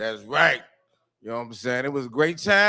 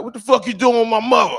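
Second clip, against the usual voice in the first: angry son; 8 semitones higher, 8 dB louder than usual. Retold or imitated. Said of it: "he asked me a question like".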